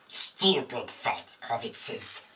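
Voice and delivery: slow, rasping, strained creature voice; Gollum. Acted. Teacher trying a character real quick, Gollum impression. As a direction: croak it like a wretched little creature. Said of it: "try to do Gollum real quick".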